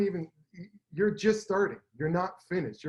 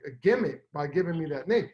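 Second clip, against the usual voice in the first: ordinary talk, nothing marked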